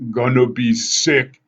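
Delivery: queasy warning. A narrator's reading. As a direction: drag it out, about to be sick. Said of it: "Tyson warned".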